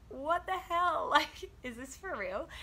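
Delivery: high, slow, laughing disbelief; what the hell, half laughing; incredulous disbelief. Retold or imitated. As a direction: laugh it off in shock. Retold disbelief at officer's request.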